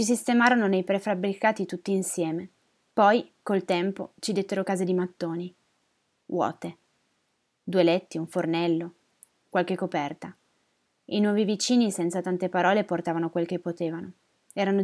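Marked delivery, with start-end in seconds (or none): none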